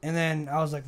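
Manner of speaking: ordinary talk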